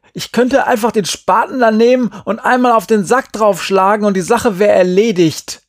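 high, loud, pressured outburst; heated threat. A narrator's reading. Boy threatens the sack of kittens mid-quarrel, heated shouting. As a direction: threaten it in sustained fury.

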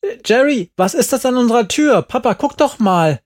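high, loud, fast alarm; alarmed calling. A narrator's reading. Ramona shouts to Jerry and her dad, high and alarmed. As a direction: point and shout in shock.

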